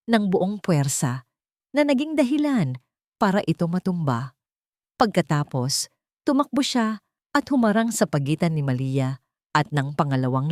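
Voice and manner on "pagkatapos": usual speaking voice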